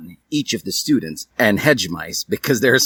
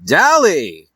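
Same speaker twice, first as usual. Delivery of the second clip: loud raised call; 7 semitones higher, 6 dB louder than usual. Acted. Sorting hat calling Dally up, loud call. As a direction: call the name across the hall. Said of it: "called the hat".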